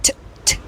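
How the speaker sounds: bare voiceless "t, t"; soft tongue-tap T bursts; plosive T. Shown as a demonstration. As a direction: release only the t consonant. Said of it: "t sound".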